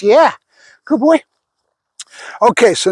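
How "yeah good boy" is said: praising the dog. Real, not acted.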